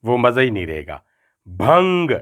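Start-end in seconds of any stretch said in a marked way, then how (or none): 1.4-2.1 بھنگ with a firmly stressed final g; بھنگ, the g punched clearly. Shown as a demonstration. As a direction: land hard on the g of بھنگ. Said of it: "گاف پر زور دے کر".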